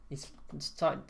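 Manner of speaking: ordinary delivery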